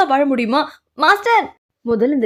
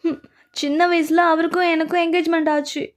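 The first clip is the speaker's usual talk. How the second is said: ordinary delivery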